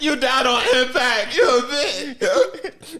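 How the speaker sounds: high, loud, drawn-out taunt; mock angry yell. Mom insulting her dead son, mock furious yell. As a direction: stretch it out in a hammed-up yell.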